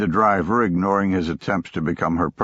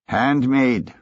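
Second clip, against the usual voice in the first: eager merchant selling hard; a hint of a pushy vendor; pushy shopkeeper voice; 5 semitones higher than usual. Pushy shop owner pitching.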